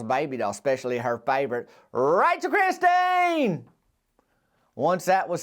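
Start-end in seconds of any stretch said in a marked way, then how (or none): 1.9-3.6 soaring high, drawn-out shouted name; triumphant shout. Acted. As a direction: present the star doll to the crowd. Grand reveal of favorite doll.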